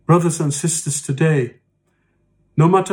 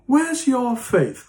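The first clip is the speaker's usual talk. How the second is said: slow, higher mock accusation; mocking accuser; 8 semitones higher, slower than usual. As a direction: drag it out, needling them. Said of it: "we go up to one another and said".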